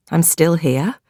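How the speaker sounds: plain reading voice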